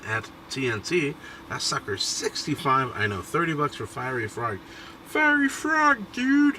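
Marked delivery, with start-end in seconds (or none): 5.1-6.6 very high, slow, stretched-out shout; hammy high-pitched hype yell; Beast voice. Retold or imitated. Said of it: "I'm trying to do my Beast impression".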